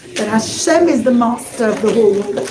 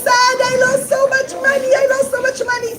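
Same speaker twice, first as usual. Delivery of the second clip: much higher, whiny mock lament; fake crying; 12 semitones higher, faster than usual. Rabbi fakes sorrow before the king, mock crying. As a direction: pretend to mourn the money, hammed-up. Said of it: "Rabbi Yitzchak pretended to cry".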